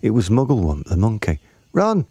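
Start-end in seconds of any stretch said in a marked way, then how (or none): 1.7-2.1 high-pitched monkey's cry of alarm; shouted warning. A narrator's reading. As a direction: call out urgently, very high. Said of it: "Mugglewump shouted to the children".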